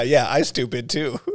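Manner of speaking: laughing. Real, not acted. Laughing at the joke.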